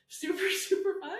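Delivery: giggling through the words; laughing. Real, not acted. Business coach laughing while pitching her program. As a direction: laugh it out, amused at herself.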